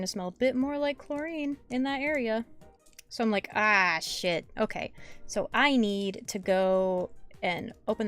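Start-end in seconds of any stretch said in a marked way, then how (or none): none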